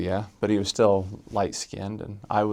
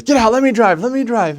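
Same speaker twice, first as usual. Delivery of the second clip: high, loud frantic order; urgent demand; 14 semitones higher, 8 dB louder than usual. Retold or imitated. Mission president demanding the wheel, high urgent yell. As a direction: imitate a panicked boss taking over. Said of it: "And he says".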